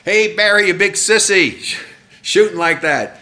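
high-pitched mock heckle from the stands; heckler's yell. Guy in the stands taunting the shooter, imitated yell. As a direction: yell it like a jeering spectator. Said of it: "Guy in the stands yells out".

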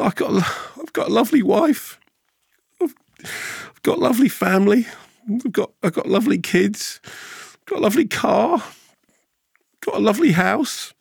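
put-on high, halting tearful old man in a joke; hammed-up crying old bloke; mock weeping. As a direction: pretend to sob through each item, voice pitched right up. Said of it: "weeping quietly to himself".

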